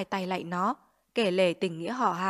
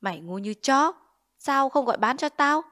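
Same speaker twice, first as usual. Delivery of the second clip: scolding; 5 semitones higher, 4 dB louder than usual. Acted. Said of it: "đến mắng mẹ tôi".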